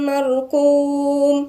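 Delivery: slow, melodic tajweed chant; measured Quranic recitation. Acted. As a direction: recite the verse slowly, drawing out the vowels. Recites slowly.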